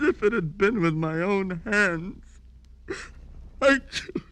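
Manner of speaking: sobbing confession, high and breaking; weeping with guilt; crying. Acted.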